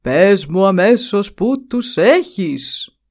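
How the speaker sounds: slow, pressing demand from the buffalo chief; lightly voiced stubborn buffalo, slow and demanding; insisting buffalo. A narrator's reading. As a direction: drag each word out, insisting. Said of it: "επέμεινε ο Βούβαλος".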